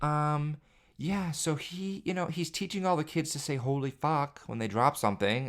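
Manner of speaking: high, hesitant awkward voice; imitating daycare worker. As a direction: deliver bad news awkwardly. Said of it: "they'll be like".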